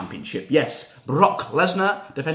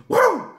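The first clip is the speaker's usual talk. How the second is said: high woo whoop; quick celebratory woo; woo cheer; 4 dB louder than usual. Real, not acted. Fan cheering, high woo.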